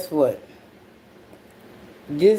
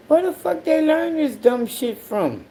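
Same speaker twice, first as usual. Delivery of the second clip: much higher, incredulous question; can't believe what you just heard; high, stunned where; baffled outrage; 10 semitones higher than usual. Retold or imitated. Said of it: "I was like".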